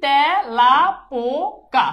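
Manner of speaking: তেলাপোকা slowly split as "tæ, la, po, ka"; teacher sounding out তেলাপোকা slowly with an open æ; syllable-by-syllable demo. Shown as a demonstration. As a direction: stretch each syllable of তেলাপোকা, opening the first to "tæ". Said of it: "এইটার উচ্চারণ হবে কি?".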